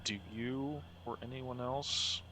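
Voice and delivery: slow, hushed question; soft secretive query; whispering. Acted. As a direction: ask it softly so only they hear. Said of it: "he's whispering as quietly as possible".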